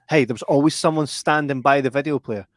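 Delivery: ordinary talk, nothing marked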